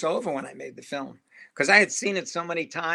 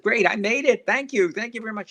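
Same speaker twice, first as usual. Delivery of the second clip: eager thanks; 6 semitones higher, 5 dB louder than usual. Retold or imitated. Thanking his star fan eagerly.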